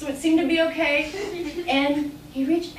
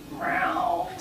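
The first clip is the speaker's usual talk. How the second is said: lion's meow; 7 semitones lower, 5 dB quieter than usual. Acted. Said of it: "And the lion said".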